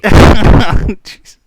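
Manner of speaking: loud laughing exclamation. Real, not acted. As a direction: blurt it through a laugh. Laughing at the joke.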